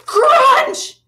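big loud chomping crunch. A narrator's reading. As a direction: bite down hard and loud. Shark bites, sound effect.

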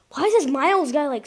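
usual speaking voice

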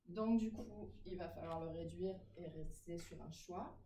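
ordinary talk

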